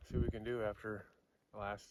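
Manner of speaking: normal talking voice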